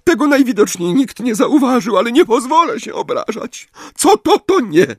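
high, tearful lament; sobbing. A narrator's reading. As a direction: sob it out. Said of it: "zaszlochała". (szlochając, wysoko)

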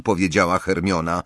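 ordinary delivery, nothing marked

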